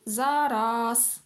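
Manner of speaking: the word „Zaraz” said in a put-on lazy kitten voice. Lazy kitten puts off his mother with „Zaraz”. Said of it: "Atlas mówił".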